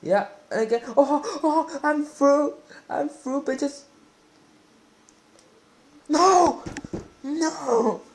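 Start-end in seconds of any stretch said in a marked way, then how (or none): 1.0-3.8 high, thrilled crowing as he breaks through; real rush of excitement, pitched up; gloating at his opponents, high and excited. Real, not acted. 6.1-8.0 high, loud cry of dismay; real frustrated shout, much higher and louder; anguished shout. Real, not acted. Missed chance on goal.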